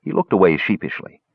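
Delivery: usual speaking voice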